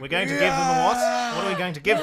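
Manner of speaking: high, very loud shouted call-and-response; Raxor rallying troops. Acted. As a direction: shout for the army's answer. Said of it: "you hear him addressing the troops".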